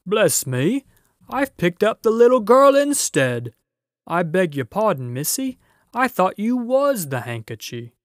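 flustered giant. A narrator's reading. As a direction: blurt out a clumsy apology. Giant voice in story.

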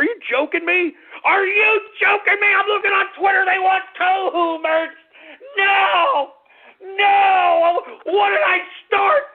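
outraged disbelief. Acted. Host reacting to fans' merch requests, incredulous shout.

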